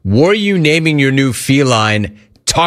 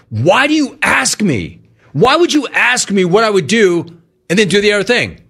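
imitating a furious father, pitched way up; furious dad, mock; 8 semitones higher than usual. Son who ignored his advice, mock furious high-pitched father. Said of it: "Dude, he was furious".

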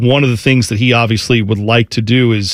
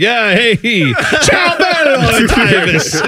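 high, stretched mock-Italian "ciao bello"; hammed-up Italian charm, drawn out high; mock Italian greeting; 9 semitones higher, much slower than usual. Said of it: "So like".

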